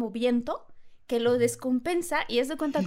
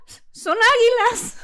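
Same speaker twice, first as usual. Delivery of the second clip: nervous realization; 11 semitones higher, 12 dB louder, slower than usual. Retold or imitated. Pilot realizes they're eagles.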